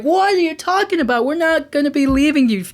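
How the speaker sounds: high-pitched voice imitating alarmed girls; high mock protest to dad; protesting daughters.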